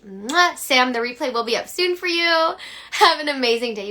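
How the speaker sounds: high, loud blown kiss and farewell; bubbly sign-off. Real, not acted. Coach blowing a kiss to Sam and viewers, gushing. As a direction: gush goodbye to your fans.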